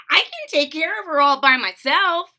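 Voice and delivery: loud, high insistence; insisting. A narrator's reading. Froggy insists to mom he can do it, loud. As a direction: prove he can do it.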